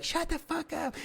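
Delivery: high falsetto crowd shout, held back in volume; mock shout in a thin high voice; mimicked crowd yell. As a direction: imitate a whole room yelling, squeaky high. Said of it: "they'd be like".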